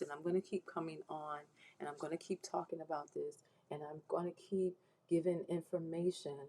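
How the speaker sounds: normal talking voice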